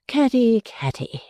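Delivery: fading, tired repeat of Caddy; weary. A narrator's reading. Mrs Jellyby tires of her daughter's small matters, weary. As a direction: sigh it out, worn down. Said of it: "quite weary of such little matters".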